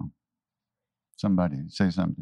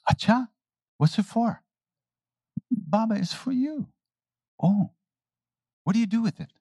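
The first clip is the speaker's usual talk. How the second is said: much higher, wide-eyed asking; innocent questioning; 12 semitones higher than usual. Retold or imitated. Maharaji playing innocent to the man with the money. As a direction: play innocent about money. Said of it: "Maharaji says".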